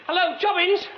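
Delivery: man trapped in a well shouting up to the caretaker, desperate yell; shouting for help. Acted. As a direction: yell high and loud for help.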